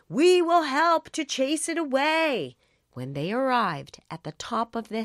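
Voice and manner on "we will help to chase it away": shouted reassurance. A narrator's reading. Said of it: "they shouted back".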